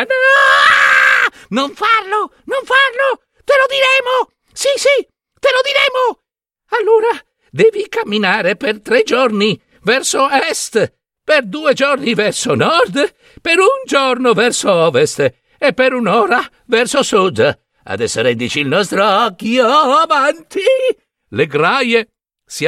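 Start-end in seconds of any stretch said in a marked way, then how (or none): 0.0-6.2 very high, squealing pleas; panicking hags. A narrator's reading. 6.8-17.6 high-pitched crone voice; hag giving directions. A narrator's reading. 17.9-20.6 crone voice climbing to a screech; demanding hag. A narrator's reading.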